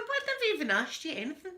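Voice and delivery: high-pitched mock indignation; indignant retort. Woman answers Alexa's unprompted remark, indignant. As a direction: pitch it right up, affronted.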